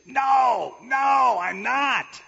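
loud high protesting no, no; mock outraged denial. Jesus imagined refusing Thomas's worship, a loud mock protest. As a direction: shout the refusal in pretend horror.

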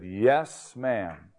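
a half-frozen parrot answering its owner, shivering and meek. Retold or imitated. Parrot pulled from the freezer answers its owner, shivering. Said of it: "That poor parrot was shivering".

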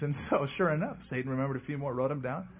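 normal talking voice

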